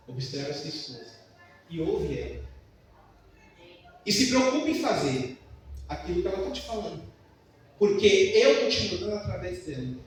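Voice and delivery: preacher voicing God gently to himself; voice of the Holy Spirit. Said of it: "veio o Espírito Santo e falou".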